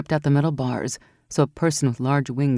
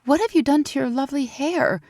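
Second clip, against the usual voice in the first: exclaimed; 10 semitones higher than usual. A narrator's reading. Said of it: "she exclaimed".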